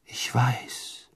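near-whispered reply; hushed answer. A narrator's reading. Harry answers the phoenix song, almost whispering. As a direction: answer very softly, almost under the breath. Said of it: "antwortete Harry der Musik".

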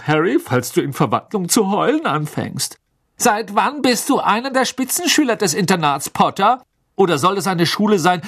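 high-pitched, spiteful schoolyard jeering; sneering classmate's taunt, pitched well up; gloating taunts. A narrator's reading. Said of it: "ihre hämischen Kommentare abgaben".

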